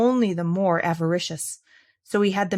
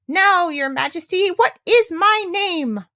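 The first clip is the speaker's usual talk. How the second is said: very high, slow, louder gloat; taunting little man; 10 semitones higher, 4 dB louder, slower than usual. Acted. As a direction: savour the question.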